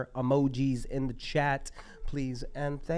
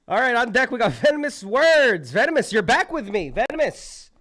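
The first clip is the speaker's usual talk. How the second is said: higher and louder, calling the next act up; hype announcer call; 10 semitones higher, 9 dB louder than usual. Real, not acted. Emcee calling the next poet to the stage, loud and hyped. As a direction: pump up the room like an MC.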